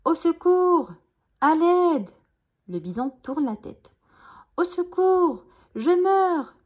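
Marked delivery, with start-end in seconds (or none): 0.1-2.1 high, loud mock cry for help; feigned wailing. Jean-Claude fakes injury to lure the bison, wailing. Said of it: "se met à gémir, comme s'il était blessé". 4.6-6.5 high, loud mock cry for help; feigned wailing. Jean-Claude fakes injury to lure the bison, wailing. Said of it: "se met à gémir".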